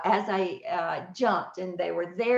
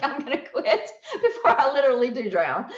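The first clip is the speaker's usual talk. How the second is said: louder, laughing through the words; laughing self-quote; 5 semitones higher, 5 dB louder than usual. Retold or imitated. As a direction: laugh it off as you give up. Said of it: "So I'm like".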